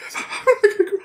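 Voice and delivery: high, squeaky whimper; mock crying. Reader acting out their reaction to grief, mock crying.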